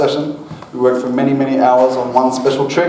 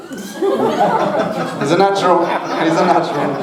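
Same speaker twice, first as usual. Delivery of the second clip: laughing; 3 semitones higher, much slower than usual. Real, not acted. Laughing praise of Tony.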